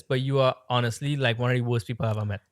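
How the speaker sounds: normal talking voice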